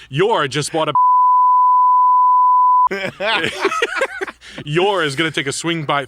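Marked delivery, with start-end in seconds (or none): 1.0-4.6 long, high, loud real laugh; loud burst of laughter. Real, not acted. Laughing hard at his own story about Yora, loud and high.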